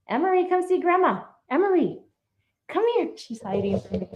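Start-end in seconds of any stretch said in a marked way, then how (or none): 0.1-3.1 high, coaxing call. Real, not acted. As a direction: call the little one over to Grandma. Calling toddler to come over.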